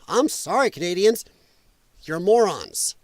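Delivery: ordinary talk, nothing marked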